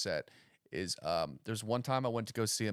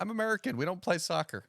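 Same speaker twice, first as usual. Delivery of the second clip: dumb American voice; 8 semitones higher than usual. Retold or imitated.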